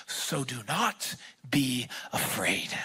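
soft unvoiced whisper, slowing down; whispered reassurance. Pastor whispering God's comfort to the congregation. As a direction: breathe it like a gentle promise.

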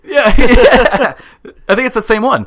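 laughing loudly while talking. Real, not acted. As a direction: talk through a laugh. Laughing at the story.

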